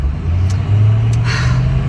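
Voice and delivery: long disappointed sigh; heavy sigh. Real, not acted. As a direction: let out a deflated sigh. Nursery found closed.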